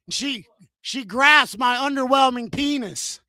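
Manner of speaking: ordinary talk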